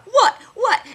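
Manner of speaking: high, loud challenging yelps; taunting what. Retold or imitated. He baits a flinching Todd, loud and high. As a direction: bark it to bait a fight. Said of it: "I was like".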